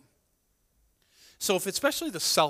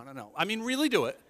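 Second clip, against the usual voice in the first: ordinary talk, nothing marked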